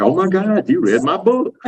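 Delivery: high squeal of surprise, imitating Amy; hammed-up delighted gasp; mock excited. Amy's excited reaction retold.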